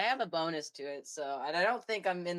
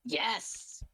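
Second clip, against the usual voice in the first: stern command; 6 semitones higher, 6 dB louder, slower than usual. Acted. Noble orders imp.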